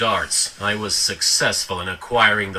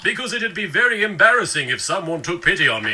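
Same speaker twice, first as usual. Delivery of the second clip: high, quick sulky whine; pouty rushed complaint; mock self-pity; 6 semitones higher, faster than usual. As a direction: whine fast, fishing for pity. Sulking, fishing for pity.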